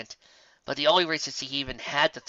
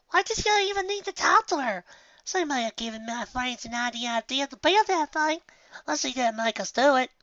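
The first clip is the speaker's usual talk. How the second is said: put-on much higher, small girl voice; little filly; 12 semitones higher than usual. Apple Bloom questioning her older sister, high filly voice. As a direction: play the young sister, curious. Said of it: "It was Apple Bloom who spoke up next".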